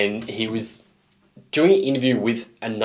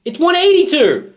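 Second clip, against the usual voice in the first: much higher, hammed-up insistence on "eighty-two"; mock outraged correction; 4 dB louder than usual. Host correcting the band's number, mock outrage. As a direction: hit "eighty-two" in high mock fury.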